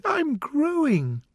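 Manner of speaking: put-on a timid, high little girl. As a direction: shrink back and say it meekly. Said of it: "said Alice very timidly".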